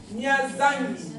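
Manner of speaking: Mia Zangi said very slowly; slow demo. Shown as a demonstration. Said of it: "tu vas entendre".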